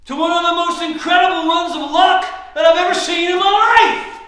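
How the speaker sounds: high, very loud tirade; angry shout. Acted. Losing player shouts at winner about her luck, furious. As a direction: holler it at your opponent in exasperation. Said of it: "Don't shout at me".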